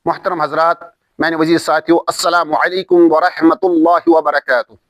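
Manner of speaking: low, even-toned Arabic invocation and salutation; formal religious opening. Acted. Teacher opens the Quran course with praise and salaam, formal recitation.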